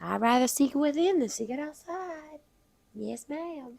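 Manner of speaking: put-on Southern belle accent. Southern belle repeats her motto on request, accent held. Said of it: "The Southern Belle herself".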